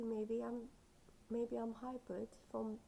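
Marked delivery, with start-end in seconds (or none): none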